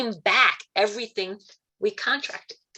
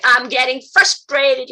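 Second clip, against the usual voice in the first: frustrated outburst; 6 semitones higher, 8 dB louder, slower than usual. Retold or imitated. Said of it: "And then I was like".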